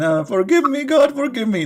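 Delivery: high plaintive plea; mock desperate prayer; praying woman.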